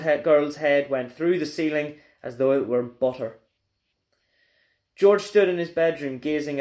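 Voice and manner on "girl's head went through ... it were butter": ordinary delivery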